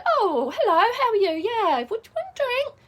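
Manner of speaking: mock-cheerful nonchalant hello; breezy unbothered greeting. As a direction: brush it off sweetly up high. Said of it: "But just to say".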